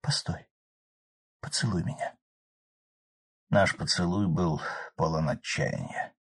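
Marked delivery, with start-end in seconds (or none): 0.0-2.1 barely audible whisper. A narrator's reading. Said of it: "чуть слышно прошёптанной просьбой".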